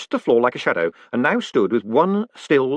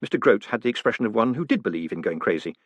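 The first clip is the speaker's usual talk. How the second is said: ordinary delivery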